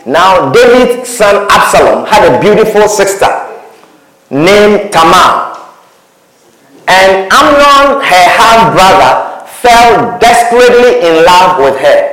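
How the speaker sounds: slow, deliberate Bible reading; slow solemn reading; scripture reading. Acted. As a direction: read the verse slowly to the church.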